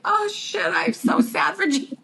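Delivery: high, pitying squeak, half joking; mock sorry for Gina. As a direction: pitch it way up in playful sympathy. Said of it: "and I'd be like".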